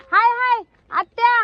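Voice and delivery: loud, high hail to an aunt; calling out. Real, not acted. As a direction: hail your aunt from afar.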